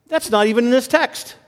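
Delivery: normal talking voice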